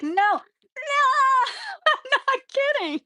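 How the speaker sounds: high, laughing cry of no; laughing protest. Retold or imitated. Retelling refusing antibiotics to the dentist, laughing high protest. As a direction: squeal the no through a laugh. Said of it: "I was like".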